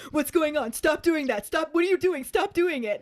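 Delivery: rising, frantic but playful; panicky Dudley. Retold or imitated. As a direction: imitate a scared cousin begging him to stop. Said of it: "he's just like".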